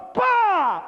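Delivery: high, sudden "pa" falling in pitch; imitated explosion bang. Survivor interrupted while picking up the phone, imitated bang. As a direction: burst out with the blast noise, high.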